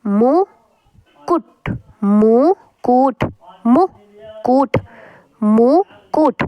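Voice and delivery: मुकुट spoken very slowly, each syllable drawn out; teacher slowly sounding out मुकुट for students. Shown as a demonstration. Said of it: "ये है मुकुट".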